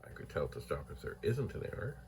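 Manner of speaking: ordinary talk, nothing marked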